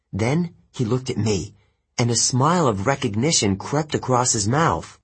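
usual speaking voice

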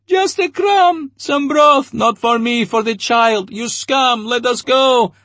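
starving crowd begging then cursing the major. A narrator's reading. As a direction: wail the pleas like a starving mob. Crowd begs and curses the major.

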